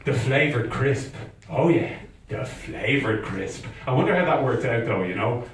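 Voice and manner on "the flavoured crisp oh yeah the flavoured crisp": slow, drawn-out words; savouring reveal. Acted. Comic revealing Ireland's great invention, savouring slowly. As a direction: linger on it with mock reverence.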